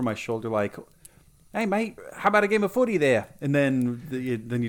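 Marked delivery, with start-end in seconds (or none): 1.5-3.3 put-on Australian accent. Light imitated as Aussie bloke.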